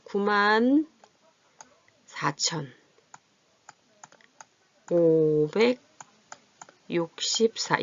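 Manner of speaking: number broken up by long pauses; piece-by-piece dictation, held syllables; drawn out while writing. Real, not acted. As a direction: say each place value as you write it.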